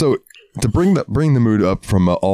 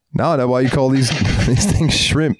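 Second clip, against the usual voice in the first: put-on Australian accent; 4 semitones higher than usual. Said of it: "He's like".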